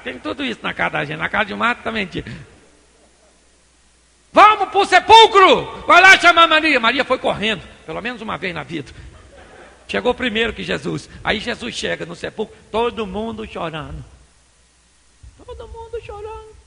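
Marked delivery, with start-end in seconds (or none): 4.3-6.8 loud, high, stretched-out shout; big raised-voice summons; commanding call. Acted. Acts out calling people to go. 15.5-16.5 very high, soft, thin voice; hammed-up high whimper; mock wailing. Mimics the crowd weeping.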